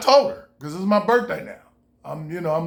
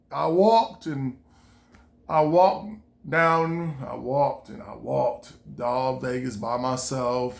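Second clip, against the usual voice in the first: slowed for emphasis; 6 semitones lower, slower than usual. Real, not acted. Dragging out the long walk.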